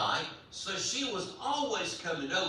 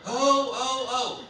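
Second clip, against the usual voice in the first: loud, high wail of oh's; mock hollering; 7 semitones higher, 7 dB louder, slower than usual. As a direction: holler it high, reliving the ride. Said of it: "And I was hollering".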